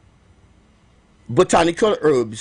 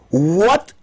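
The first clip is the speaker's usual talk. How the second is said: usual speaking voice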